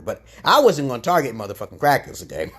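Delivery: ordinary delivery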